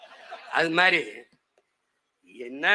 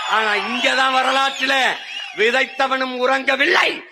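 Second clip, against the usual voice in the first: higher, slow oratorical build; rousing declamation; 5 semitones higher, 3 dB louder, slower than usual. Real, not acted. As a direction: declaim it slowly to a crowd. Rally declamation answering Castro quote. (மெதுவான, உணர்ச்சிமிக்க மேடை முழக்கம்)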